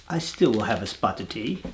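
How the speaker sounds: lightly attempted English accent, low and soft; a hint of mock English accent. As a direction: have a go at an English accent, half-heartedly. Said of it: "I can't really do an English accent".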